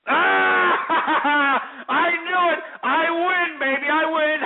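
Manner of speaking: loud, high, laughing victory shout; triumphant whoop. Real, not acted. Host gloating over guessing the caller right, shouting with laughter. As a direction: crow over winning the guess.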